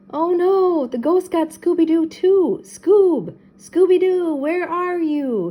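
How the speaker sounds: high, loud frantic calls; panicked shouts for his dog; Shaggy calling frantically for Scooby. A narrator's reading. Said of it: "he called".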